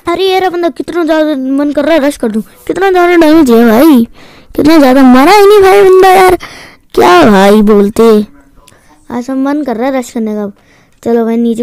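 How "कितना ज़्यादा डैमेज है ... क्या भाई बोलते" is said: loud, raised-pitch gamer outburst; excited shouting. Real, not acted. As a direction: shout in disbelief at the game. Gamer exclaims as enemy survives. (चिल्लाकर, हैरानी से)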